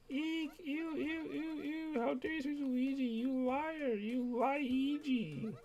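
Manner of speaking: very high, scornful squealing; disgusted fangirl. Acted. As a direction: recoil and scold the fake in disgust. Fan disgusted at imposter.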